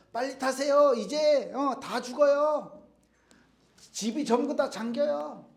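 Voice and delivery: urgent shout. Acted. Helicopter rescuer shouting to the man on the roof, urgent slow yell. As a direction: yell over the helicopter noise, pleading.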